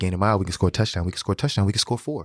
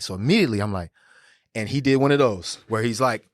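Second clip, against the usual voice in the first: ordinary talk, nothing marked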